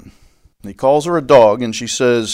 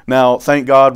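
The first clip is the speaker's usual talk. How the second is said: ordinary talk, nothing marked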